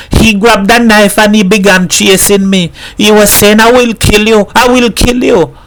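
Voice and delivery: high, shaken retelling; frightened. A narrator's reading. Missus Herrera tells the mother of the knife, frightened. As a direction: pour it out, still scared. Terrified woman recounts knife attack.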